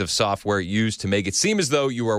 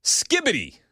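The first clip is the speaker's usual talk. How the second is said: excited; 13 semitones higher than usual. Acted. Announcing new dictionary word.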